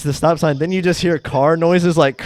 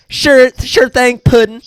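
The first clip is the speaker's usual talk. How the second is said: higher, slow sweet-talk; mock lovey boyfriend; 10 semitones higher, 4 dB louder, slower than usual. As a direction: drawl it out to his girlfriend. Voicing boyfriend in sketch.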